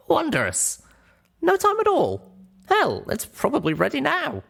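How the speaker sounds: put-on high, eager young pony voice; small mare walking friends through a rescue; young Littlepip explaining. Littlepip reassures about the glue.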